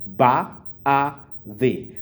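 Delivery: "Ba, a, the" split apart with small breaks; syllable by syllable. Shown as a demonstration. Teacher breaking a word into syllables for the class. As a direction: spell "Ba, a, the" out as three syllables. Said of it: "We have three syllables".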